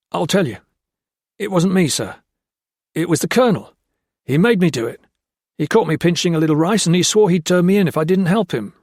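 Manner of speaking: high, loud and fast, blurting it out; panicked confession. Acted. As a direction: spill the confession in a frightened rush.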